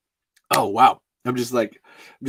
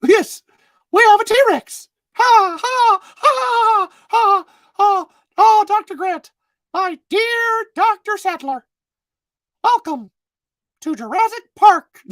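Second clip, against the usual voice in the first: very high squeaky falsetto mouse; Mickey Mouse; 4 dB louder, slower than usual. Acted. Park host greeting visitors to Jurassic Park, Mickey Mouse voice. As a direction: pipe it up high and loud, like a cartoon mouse. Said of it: "Mickey Mouse welcoming you to Jurassic Park".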